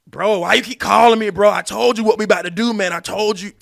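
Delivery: loud, high yelling into the phone; angry shout. Acted. Yelling at a pestering client on the phone, high and loud. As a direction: bark at the caller who keeps ringing.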